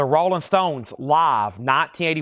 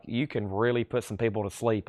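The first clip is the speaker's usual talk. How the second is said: a hint of a droning, soporific talker; mock sleepy drone; 4 semitones lower, 8 dB quieter than usual. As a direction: lower and softer, a drowsy monotone. Family mocking his sleepy voice.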